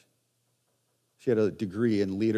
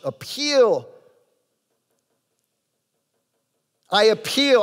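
high, louder plea on appeal; 9 semitones higher, 5 dB louder, slower than usual. Shown as a demonstration. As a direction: sound out the plea. Preacher demonstrating the word.

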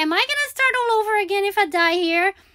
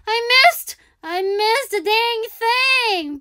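whiny groan; 6 semitones higher, slower than usual. Real, not acted. Missed jump, groaning.

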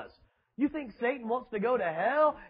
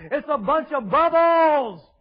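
loud, higher, slower dismissal; shouting; 7 semitones higher, 10 dB louder, slower than usual. Real, not acted. Preacher shouting at his congregation, loud and slow. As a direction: hammer it home with a shout.

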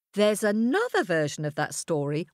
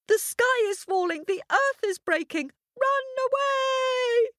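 panicked shout. A narrator's reading. Hare shouting the warning to another hare, high and frantic. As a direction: cry the warning in terror. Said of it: "all running ... and shouting".

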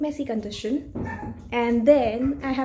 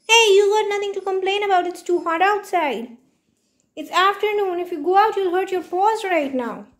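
high scolding pet voice; 5 semitones higher, 10 dB louder than usual. Real, not acted. Scolding the whining dog.

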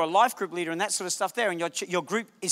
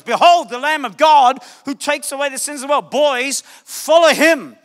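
loud, raised proclamation; John the Baptist proclaiming; 5 semitones higher, 8 dB louder than usual. Acted. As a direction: announce him to the crowd. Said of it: "he goes".

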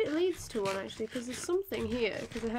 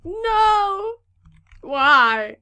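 retold despairing cry; 7 semitones higher, 14 dB louder than usual. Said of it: "I was like".

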